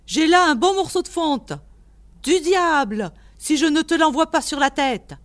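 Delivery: loud, high blustering threat; irate threat. A narrator's reading. Old man threatens to throw cast iron at caller, irate. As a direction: warn the intruder off at full voice.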